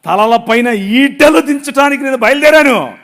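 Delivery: loud, ringing sermon delivery; full-force preaching. Acted. Pastor telling the congregation Habakkuk's story, loud preaching. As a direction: proclaim it to the whole hall.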